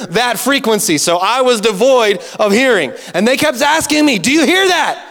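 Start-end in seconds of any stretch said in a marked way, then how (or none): 4.2-5.0 pestering neighbor. Retold or imitated. Neighbors keep asking.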